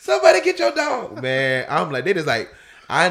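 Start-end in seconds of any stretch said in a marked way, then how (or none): 0.0-1.2 high, loud comic cry for help; panicked yell. Retold or imitated. Runner fleeing a pit bull calls out to the owner, hammed-up panicked yell.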